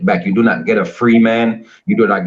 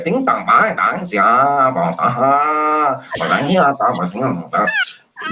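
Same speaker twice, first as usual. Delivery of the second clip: slow, drawn-out fake Chinese; sing-song nonsense imitating Chinese; mock Chinese; 4 semitones higher, slower than usual. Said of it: "some Jamaicans even talk Chinese".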